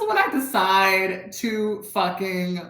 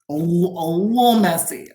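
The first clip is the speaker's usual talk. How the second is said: normal talking voice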